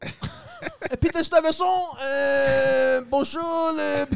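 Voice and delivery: very high, loud, floundering mock-French; bad French accent. Tourist fumbling in a tobacco shop, bad French accent. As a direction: shout it in a clumsy, hammed-up French accent. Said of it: "my accent's no good".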